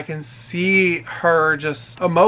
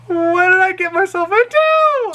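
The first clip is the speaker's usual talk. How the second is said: very high, sustained comic cry; mock despairing wail; 4 dB louder than usual. Driver laments taking on drunk passenger, mock wail. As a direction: wail it out as exaggerated regret.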